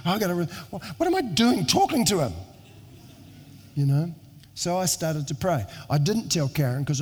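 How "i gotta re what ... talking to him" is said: rising, higher and higher in alarmed self-talk; catching himself mid-thought, voice climbing; sudden realization. Retold or imitated. Pastor recalling his own startled thought, sudden alarmed realization. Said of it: "And I thought".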